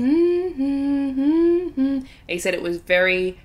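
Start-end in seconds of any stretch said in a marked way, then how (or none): 0.0-2.0 higher eerie hummed tune; creepy children's humming. Retold or imitated. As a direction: hum it like a ghost child. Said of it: "like children's humming".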